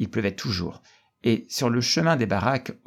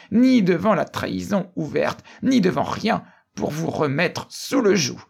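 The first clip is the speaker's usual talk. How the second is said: high-pitched fervent oratory; raised, driving declamation; impassioned cry; 7 semitones higher than usual. A narrator's reading. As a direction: hurl the enemy's answer at the crowd. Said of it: "crier d'une voix étouffée".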